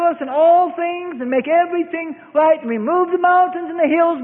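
mock prayer for an easy life; mock pious prayer. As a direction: pile up the pleading like a showy prayer. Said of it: "How would you like to say".

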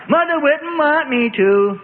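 high, slow whiny mimicry; mocking ruffian. A narrator's reading. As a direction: ape a goody-goody in a sneer. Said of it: "mocked a ruffian".

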